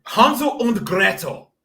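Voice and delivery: Hansel und Gretel with German vowels; German accent. Shown as a demonstration. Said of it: "I pronounced it in, like, a German accent".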